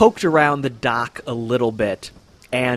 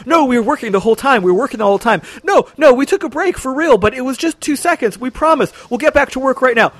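very high, fast, babbling denials; terrified workers swearing they were working; panicked excuses; 12 semitones higher, 5 dB louder, much faster than usual. Acted. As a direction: gabble excuses over each other. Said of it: "They're actually talking over each other".

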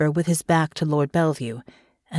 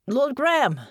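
higher call from across the street; 9 semitones higher than usual. A narrator's reading. The clerk calls to Graham across the street, calling out. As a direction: hail him from afar. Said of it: "a man's voice calling from across the street".

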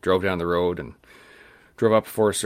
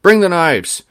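high, loud excited call; old man calling his wife over, excited; 9 semitones higher, 9 dB louder than usual. Retold or imitated. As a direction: shout the news across to his wife.

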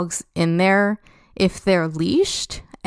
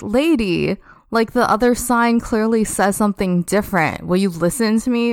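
ordinary talk

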